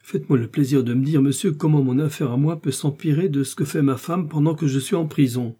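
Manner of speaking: mildly indignant, insistent demand; a touch of aggrieved protest; exasperated. A narrator's reading. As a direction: demand an explanation with understated exasperation. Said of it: "le mercier exaspéré".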